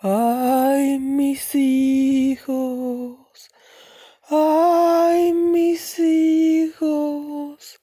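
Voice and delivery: high, very slow drawn-out wail; weeping ghost woman. Acted. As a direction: wail it like a ghost mother searching for her lost children. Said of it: "it goes something like this".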